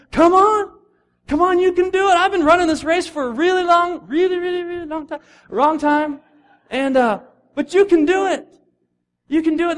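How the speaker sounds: put-on rally the young runners from the track; cheering old runner. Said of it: "he's just like".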